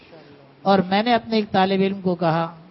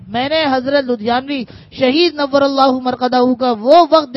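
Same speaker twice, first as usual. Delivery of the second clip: loud, pitched-up sermon delivery; preaching at full force; 4 semitones higher, 7 dB louder than usual. Acted. Urging students and scholars to take it to heart, loud preaching.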